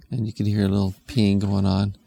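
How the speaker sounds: ordinary delivery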